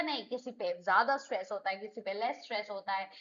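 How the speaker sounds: ordinary talk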